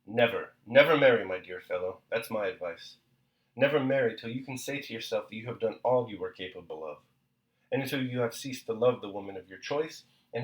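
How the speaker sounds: husband warning his friend, agitated. A narrator's reading. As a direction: urge a friend urgently, hitting never. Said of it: "as one who has long had something on his mind".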